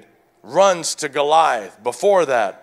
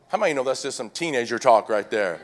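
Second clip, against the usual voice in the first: normal talking voice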